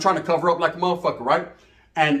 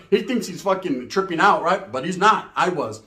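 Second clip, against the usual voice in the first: ordinary talk, nothing marked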